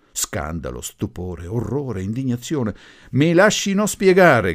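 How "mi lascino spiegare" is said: loud, high-pitched call over protests; shout. A narrator's reading. Professor over a chorus of protests, shouting. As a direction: shout to be heard. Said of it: "gridò il professor Gori".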